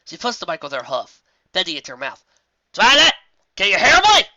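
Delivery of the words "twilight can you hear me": high, loud half shout; half shout into the mic. Acted. Applejack half shouts into the headset mic, high and loud. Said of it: "she half shouted".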